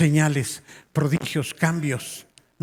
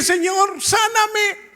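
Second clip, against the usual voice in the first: very high, drawn-out cry to God; high-pitched imploring retold voice; imitating tearful plea; 3 dB louder, much slower than usual. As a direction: act out a desperate prayer for healing. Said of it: "estaba llorando cuando decía eso".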